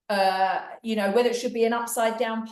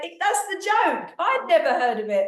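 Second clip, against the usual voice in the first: retold exasperation; 7 semitones higher than usual. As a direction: protest as if they missed the point. Retelling own protest to the commenter.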